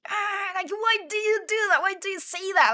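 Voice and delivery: high, exasperated "why do you do that"; pissed-off whine. Retold or imitated. Schoolboy annoyed at his classmate's teasing, exasperated whine. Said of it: "Nishikata was pissed off".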